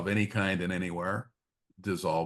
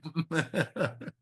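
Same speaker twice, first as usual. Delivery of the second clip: a real laugh, higher and falling; a short amused laugh; 9 semitones higher than usual. Real, not acted. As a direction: laugh at the joke. Amused by sitcom line.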